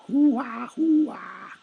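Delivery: very high, loud war whoop; battle cry hoo-ah. Acted. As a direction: whoop like a charging soldier. Battle cry while attacking.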